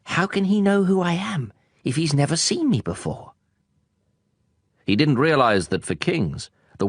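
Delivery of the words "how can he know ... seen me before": wondering little prince. Acted. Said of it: "the little prince wondered".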